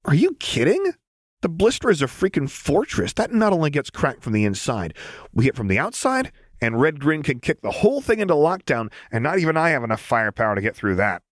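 high, fast, incredulous rant; high-pitched know-it-all Jinx; scoffing Jinx. Acted. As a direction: scoff and lay it out with gusto. Jinx voiced explaining.

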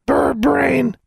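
very high, loud talking-bird voice; piercingly high parroted insult; cooing raven. A narrator's reading. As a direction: squawk it back like a pet bird. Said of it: "Charlie cooed back".

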